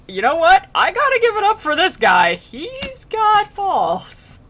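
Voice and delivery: high, loud, impressed cheer; big mock applause voice, drawing out the last words; hammed-up admiration. Dante giving it up for a defiant soul, loudly impressed.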